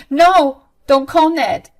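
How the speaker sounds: loud, high urgent no; urgent plea. Acted. Mabel begs Violet not to call Ned, urgent panic. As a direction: stop her in a panic.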